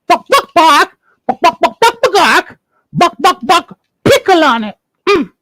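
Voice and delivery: high clucking hen; magic chicken. Acted. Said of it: "And the magic chicken said".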